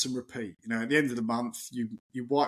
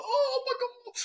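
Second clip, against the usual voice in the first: squealing far higher, laughing with glee; gleeful high-pitched squeal with a laugh; overexcited squeal; slower than usual. Retold or imitated. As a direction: burst out giddy like a kid who just got the call. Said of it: "I'm that excited".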